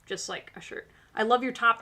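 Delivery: ordinary talk, nothing marked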